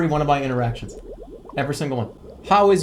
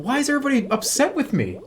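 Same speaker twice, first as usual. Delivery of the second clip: exasperated whine; 10 semitones higher, much faster than usual. Real, not acted. Exasperated at the fish.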